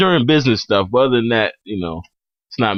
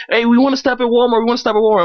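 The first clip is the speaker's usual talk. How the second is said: put-on higher, pleading childish nagging; whiny kids; 7 semitones higher, faster than usual. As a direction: beg like a kid in the back seat. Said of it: "they were like little kids".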